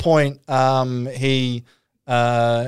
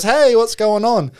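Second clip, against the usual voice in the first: casual friendly greeting; 7 semitones higher, slower than usual. Retold or imitated. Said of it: "Max comes over and goes".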